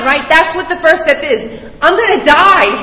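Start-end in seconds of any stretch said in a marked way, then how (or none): 1.8-2.7 mock panic. Acting terror of crashing plane.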